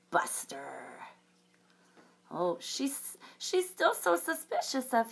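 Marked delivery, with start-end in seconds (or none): 0.1-1.0 whispered threat; menacing whisper. Acted. Dot warning the baby wolf in a whisper. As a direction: breathe the name like a warning.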